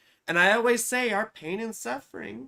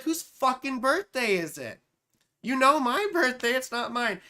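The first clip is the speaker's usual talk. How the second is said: retold indignation; 5 semitones higher, 5 dB louder than usual. Retold baffled reply to birthday call.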